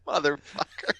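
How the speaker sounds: laughing, high-pitched mock scolding; amused disbelief. Real, not acted. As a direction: laugh at your friend's ridiculous invention. Laughs at the made-up aunt's name.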